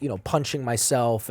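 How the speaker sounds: ordinary delivery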